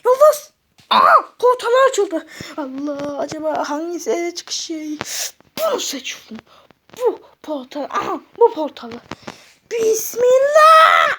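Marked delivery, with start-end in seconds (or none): 2.6-9.0 hushed nervous whisper. Acted. Choosing a portal nervously. 9.7-11.1 slow, loud bracing cry before the jump. Acted. Jumping into the portal.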